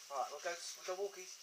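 normal talking voice

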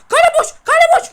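high, loud urgent cry; urgent shout. Real, not acted. Kid seeing an open goal, shouting urgently. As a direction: yell that the goal is empty.

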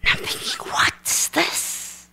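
hushed, voiceless aside; whispered thought. Retold or imitated. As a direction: whisper it to yourself, puzzled. Aside thought amid retold prayer.

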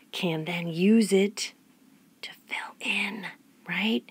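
slow whispered aside; hushed, excited lecture whisper. Real, not acted. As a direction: drop to a slow secret whisper.

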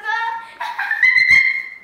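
high, loud, slow laughing protest. Real, not acted. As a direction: squeal it out through giggles. Insisting massage tickles.

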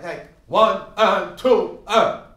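slow, stiff, loud one and two; stiff counting. Shown as a demonstration. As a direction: hit each count rigidly. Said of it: "You become very stiff".

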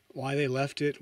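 ordinary delivery

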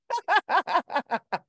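bright high laugh at the smiles; real laughter. Real, not acted. Host laughing at the class's big smiles. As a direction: laugh freely, delighted.

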